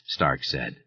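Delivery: plain reading voice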